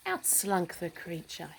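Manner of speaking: plain reading voice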